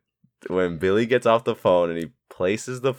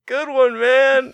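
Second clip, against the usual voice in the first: much higher, louder strained voice; mock pained Stu; 7 dB louder, much slower than usual. Stabbed Stu praising his friend, high and strained. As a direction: squeeze it out high through the pain. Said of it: "he's like".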